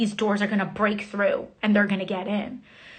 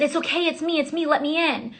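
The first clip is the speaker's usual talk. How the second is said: higher-pitched plea through a door; mildly urgent, raised-pitch reassurance; retold urgent plea; 5 semitones higher than usual. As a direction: re-enact begging to be let in. Retold pleading while banging on door.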